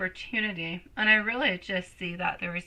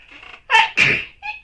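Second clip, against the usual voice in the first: loud sudden sneeze; one sharp real sneeze; sneezing; 10 dB louder than usual. Real, not acted. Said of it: "I'm sorry for the sneeze".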